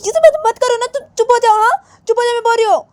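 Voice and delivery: very high, loud screaming order; furious outburst. Acted. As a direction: scream at them to shut up. Acted outburst ordering silence. (गुस्से में चीखकर चुप कराते हुए)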